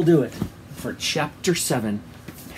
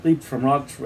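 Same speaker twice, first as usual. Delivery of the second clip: ordinary delivery, nothing marked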